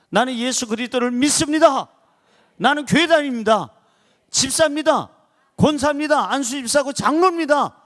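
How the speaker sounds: ringing proud confession; bold loud declaration. Shown as a demonstration. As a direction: announce your faith out loud to the room. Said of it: "이렇게 소리를 내야".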